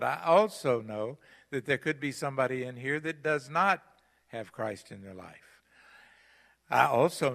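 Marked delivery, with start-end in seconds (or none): 3.5-3.8 not punched higher and louder; stressed not. Real, not acted. Pastor warning the congregation, not stressed. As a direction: hit the word not hard. Warning that some lack Christ.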